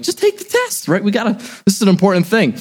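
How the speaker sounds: squeaky high, pleading push; exasperated urging. Retold or imitated. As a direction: beg her to just do it already. Nagging wife to take test.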